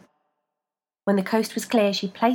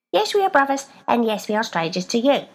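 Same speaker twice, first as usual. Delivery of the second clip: higher, louder insistent voice; feet voice; 5 semitones higher, 5 dB louder than usual. A narrator's reading. Feet insisting to the woman, higher voice. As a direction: insist with odd pride.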